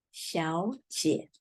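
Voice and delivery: 小姐 said softly, first syllable in a rising second tone; tone sandhi 小姐. Shown as a demonstration. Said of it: "So we pronounce".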